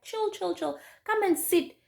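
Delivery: high, repeated hushing; retold calming, pitched high; imitated friend soothing. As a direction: settle down someone in a panic. Friend calms her down, retold.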